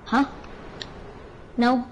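ordinary delivery

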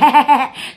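loud, high burst of laughter; taunting laugh. Real, not acted. Streamer mocking Ô Xuyên, taunting laugh.

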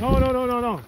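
high, loud no-no-no; loud protest. Real, not acted. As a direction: yell no over and over. Protesting loudly.

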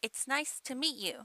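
"meet you" as mee-you, T unreleased; dropped T. Shown as a demonstration. Teacher repeating the variant, T in "meet" swallowed. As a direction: hold back the T in "meet". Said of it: "Tをあまり発音しません".

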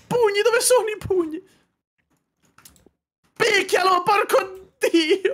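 high, loud yelling in the heat of play; excited high-pitched shouting; real gamer shout. Real, not acted. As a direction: yell it out, high and forceful. Frantic in-game fight.

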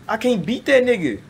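high-pitched exasperated disbelief. Real, not acted. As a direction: whine it in disbelief. Facing too-strong enemies.

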